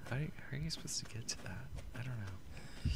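whispering. Real, not acted. Hiding, muttering to self.

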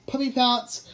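high-pitched playful battle cry; excited cry. A narrator's reading. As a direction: cry it out as you pounce. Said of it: "cried Kia as she hurled".

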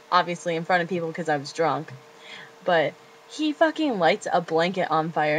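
ordinary delivery

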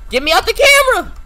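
scared horror game streamer. Real, not acted. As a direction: scream it in real panic. Trapped in cameras, panicking.

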